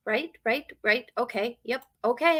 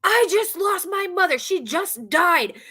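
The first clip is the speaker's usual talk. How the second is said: retold scream; 8 semitones higher, 3 dB louder than usual. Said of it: "and screams at me".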